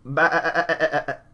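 drawn-out slow bleat; goat bleat. Retold or imitated. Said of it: "Scapegoat is more of".

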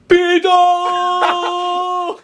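very high, long, loud yell; kid's alarm shout. Acted. Kid calls out the approaching stranger, high drawn-out shout. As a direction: holler it out to the whole street.

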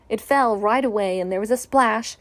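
usual speaking voice

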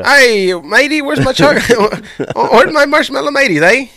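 loud, high-pitched Irish send-up; hammed-up Irish, breaking into laughter; mock Irish accent. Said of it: "Yeah, Irish".

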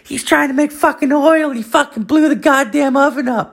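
very high squeaky child impression; nine-year-old kid. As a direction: mimic a little kid tattling in falsetto. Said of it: "nine-year-old is like".

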